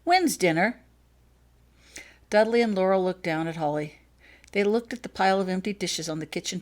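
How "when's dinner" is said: put-on higher, louder little girl's voice; a small girl telling her mother she's hungry; piping up. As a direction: chirp it like a hungry child. Child voiced in story.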